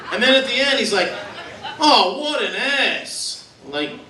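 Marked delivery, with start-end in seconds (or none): 1.8-3.4 high, very drawn-out heckle; mock yelling fan. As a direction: groan it at the TV like a sports fan. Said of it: "then he yells at the screen".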